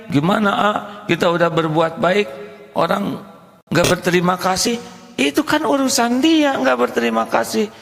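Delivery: high, whiny complaining voice; a grumbling congregant who feels cheated; a hint of a whiny complainer; complaining listener.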